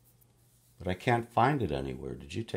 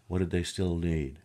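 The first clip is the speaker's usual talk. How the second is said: usual speaking voice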